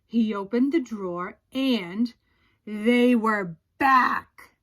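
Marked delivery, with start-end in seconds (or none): 2.6-4.5 slow and louder on back; dramatic reveal. A narrator's reading. Narrator revealing the underwear's return, slow and louder. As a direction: draw out the scary reveal.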